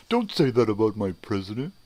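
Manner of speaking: high, loud whiny mock voice; mocking offended supporter. Mocking a supporter defending his president, in a high, loud whiny voice. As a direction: whine it like a huffy fan. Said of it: "smart-ass remarks like".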